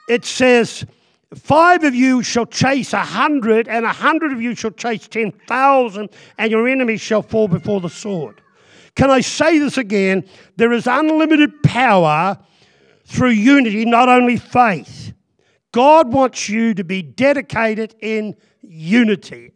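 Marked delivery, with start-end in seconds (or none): 1.3-8.4 preaching. Acted. Preaching scripture at full force. 9.0-19.5 loud full-force sermon; preaching. Acted.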